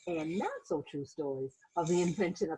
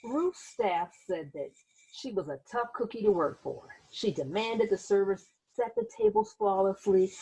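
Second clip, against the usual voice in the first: put-on gentle read-aloud narration for children; teacher reading a picture book aloud; story narration; 4 dB louder than usual. A narrator's reading. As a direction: tell it like a bedtime storybook.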